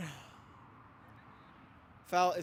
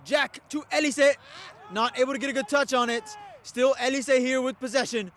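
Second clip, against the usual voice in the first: loud, high excited play call; excited commentary; 6 semitones higher, 8 dB louder than usual. Real, not acted. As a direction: raise it as the attack builds.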